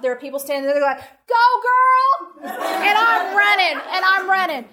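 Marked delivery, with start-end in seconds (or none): 1.3-2.5 high, loud cheering call; cheering bystanders. Retold or imitated. 2.5-4.7 excited running. Real, not acted. Recounting the chase, laughing.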